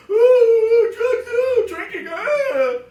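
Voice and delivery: high, loud hammed-up crying monologue; sobbing and wailing over the drugs, over the top; mock sobbing actor. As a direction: parody a weepy actor milking the tears. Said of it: "He's basically copying the other guy".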